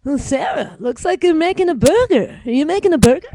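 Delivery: put-on chirpy small-town Ottawa suburb accent; Stittsville accent. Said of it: "The Stittsville accent".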